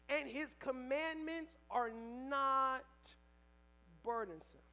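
usual speaking voice

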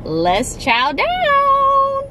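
high, drawn-out hype call; excited catchphrase. Acted. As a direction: sing it out slowly, big and high. Catchphrase to start eating.

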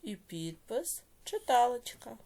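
slow, drawn-out dictation with long pauses. Shown as a demonstration. Teacher dictating a note to pupils, slow measured dictation. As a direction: dictate word by word, stretching syllables for writers.

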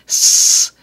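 sharp, loud imitated cockroach hiss. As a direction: spit out a loud snake-like hiss. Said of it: "these hissing, hissing cockroaches".

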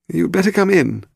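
high-pitched, grudging little man; reluctant Willie voice. Acted. Boat owner letting Paul and Harry aboard, high reluctant voice. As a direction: give in with a high, put-on voice.

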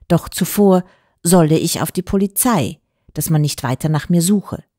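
ordinary delivery, nothing marked

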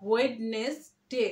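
slow, separated "WED-nes-day". Shown as a demonstration. Teacher showing how we say it, slow spelled-out pronunciation. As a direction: break ওয়েডনেসডে into three slow syllables. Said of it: "এভাবে বলি".

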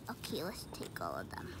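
whispered aside to herself. Real, not acted. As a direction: hush it, barely voiced. Whispered aside while playing.

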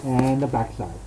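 muffled behind mask; speaking inside snorkel mask; through a full face mask. Real, not acted. Seen 0: Full-face snorkel mask worn over the whole face throughout the labelled words.

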